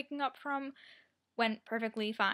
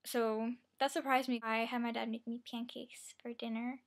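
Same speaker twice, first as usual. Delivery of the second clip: ordinary talk, nothing marked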